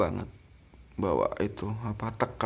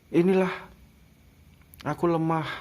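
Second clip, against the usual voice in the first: mock weak old man; 6 semitones higher, 4 dB louder than usual. Old man admitting weakness.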